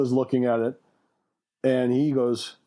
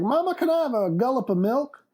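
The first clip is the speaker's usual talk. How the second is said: put-on higher-pitched little kid asking; kid character; 10 semitones higher than usual. Sam asks his mother for milk, high kid voice. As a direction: put on a pleading child's pitch. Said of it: "he's like".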